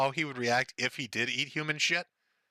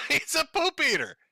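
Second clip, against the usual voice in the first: laughing, pitched high; 12 semitones higher than usual. Real, not acted. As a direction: giggle through the words. Laughs while mocking Ralph.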